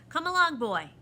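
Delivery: put-on loud, impatient snap at a boy; snapped order. A narrator's reading. The proc orders a boy to come along, snapping. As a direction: snap it out, high on the first words. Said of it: "the proc snapped".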